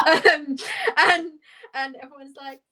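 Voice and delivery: normal talking voice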